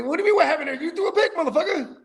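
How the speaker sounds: high-pitched mock outrage; acted angry retort. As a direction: throw it back in exasperated disbelief.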